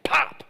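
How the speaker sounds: high whispered pop; mock balloon pop. Popping a little kid's balloon, a sudden high whispered pop. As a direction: puff out a sharp whispered pop. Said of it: "then be like".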